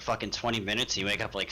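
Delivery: ordinary talk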